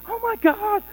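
high-pitched, frantic mock shriek; hammed-up panicked yelling; acted screaming. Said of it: "She starts screaming".

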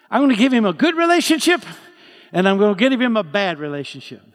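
God announcing his plan with gusto; emphatic declaration. Retold or imitated. God declaring what he will give David, loud, fast, emphatic.

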